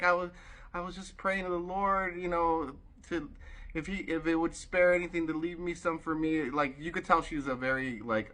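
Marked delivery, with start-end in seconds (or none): 0.0-6.5 high, earnest woman's voice; acted heartfelt testimony; imitated moved woman. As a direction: mimic her confiding a prayer, pitched up. Voicing the moved woman again.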